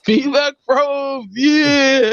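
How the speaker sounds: slow, high, proclaiming shout; mock shout. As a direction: announce it like a grand title. Jokingly proclaims the name.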